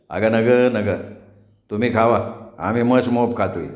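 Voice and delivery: a hint of an older man; landowner. A narrator's reading. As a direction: wave off the offer kindly. Voices the landowner.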